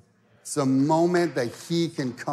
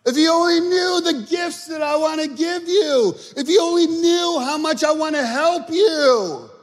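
very high, sustained preacher's shout; ringing shouted appeal in Jesus' words; full-force preaching as Jesus; 13 semitones higher, 8 dB louder than usual. Acted. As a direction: cry it out loud and high, plea after plea. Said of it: "Jesus goes".

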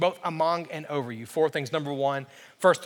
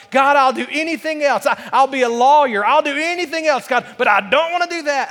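louder, higher bargaining with God; pleading protest; 9 semitones higher, 9 dB louder than usual. Retold or imitated. Reluctant young believer bargains with God over his calling, pleading protest. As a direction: argue your way out, voice raised.

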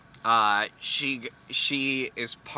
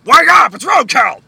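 screaming wake up; shouting; 19 dB louder than usual. Acted. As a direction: yell it at full blast. Shouting to break the trance.

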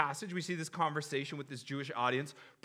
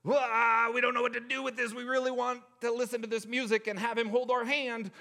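high, loud panicky fan voice; hammed-up frantic crowd; mock uproar; 9 semitones higher, 4 dB louder than usual. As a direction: ham up the outraged crowd's fluster. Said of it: "sent people into this uproar".

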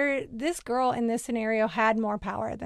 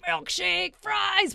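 high-pitched gleeful exclamation; acting out giddy teenage anticipation; excited; 7 semitones higher, much slower than usual. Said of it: "We were so excited".

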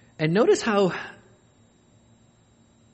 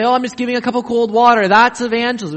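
people say, louder and quick; preacher mocks a smug claim, in a brisk self-satisfied voice; mock smug quote; 7 semitones higher, 8 dB louder, faster than usual. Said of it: "People say".

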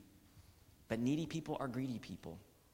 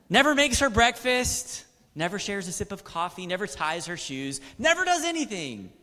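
louder and higher with each Never, peaking in a cry; preaching at full force; 6 semitones higher, 9 dB louder than usual. Acted. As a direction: build the list to a shouting climax. Building sermon list.

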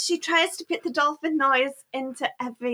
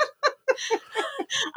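high laugh; 13 semitones higher than usual. Real, not acted. Laughing while recalling the episode.

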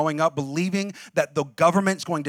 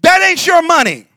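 mock stern parent; 6 semitones higher, 6 dB louder than usual. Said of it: "be like".

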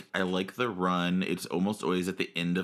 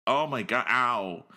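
mock pain; 7 semitones higher, much slower than usual. Lovers bump heads.

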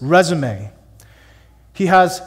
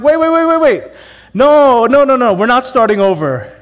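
high, loud frantic "wait, wait" from the winning players; hammed-up alarm of a winner who won't start over; panicked mock protest; 7 semitones higher, 9 dB louder than usual. Winning Monopoly players refuse restart.

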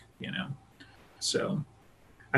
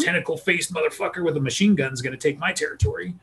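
higher, swaggering tough-guy claim; imitating a gangbanger; 5 semitones higher than usual. Gang member defending his territory from invaders, swaggering. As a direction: play a street tough staking his turf. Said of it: "be like".

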